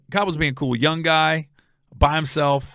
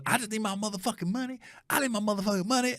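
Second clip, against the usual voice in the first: high-pitched, softer put-on voice; mimicked demanding woman; 8 semitones higher, 6 dB quieter, faster than usual. Woman on his bed demanding payment, high insistent whine. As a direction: whine the repeated demand in imitation.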